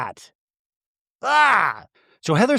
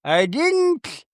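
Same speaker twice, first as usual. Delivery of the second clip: high put-on voice in a mock-offended protest; impersonating a cast member; 11 semitones higher, slower than usual. Recapper mimicking Meredith denying she yelled on the plane, high mock voice. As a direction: squeak the denial out high and slowly. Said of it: "And when he's like".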